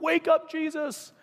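high, drawn-out urgent cry; acted-out alarmed call, slow and high; panicked wake-up call. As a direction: rouse a sleeper in mock panic. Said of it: "they're all freaking out".

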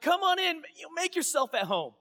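high, bright-eyed welcome; playful host greeting the guest; mock welcoming host. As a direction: chirp the invitation like an eager host.

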